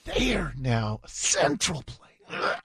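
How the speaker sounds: slow, strained mock sincerity; Robot Jenny. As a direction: drag out the stressed words as if in discomfort. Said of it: "it just shows her sincerity when she emphasizes certain words".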